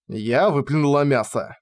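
ordinary delivery

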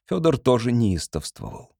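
ordinary delivery, nothing marked

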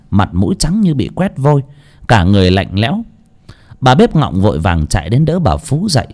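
ordinary delivery, nothing marked

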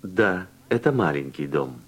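ordinary talk

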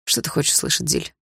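breathed out on a weary sigh; heavy sigh. Acted. Tired Lyokha asks Dilara, sighing and breathy. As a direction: sigh the question out, unvoiced. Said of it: "тяжело вздохнул Лёха".

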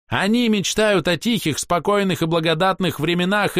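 haughty elf, high ringing voice; theatrical, scornful ranting; proud elf. Acted. Elf Castiel's tirade.

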